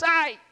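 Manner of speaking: sharp high yelp of pain; a quick high cry as he falls; ouch cry. Acted. Boy falls in the mountains, high yelp of pain.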